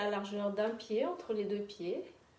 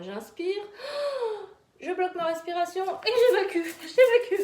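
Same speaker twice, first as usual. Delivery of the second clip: loud release demo; 10 semitones higher, 7 dB louder than usual. Shown as a demonstration. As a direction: gasp in, then high loud release. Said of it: "vous allez le refaire une deuxième fois".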